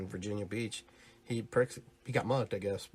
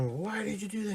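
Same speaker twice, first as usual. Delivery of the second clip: mock shock; 10 semitones higher than usual. Crowd voiced in Batman retelling.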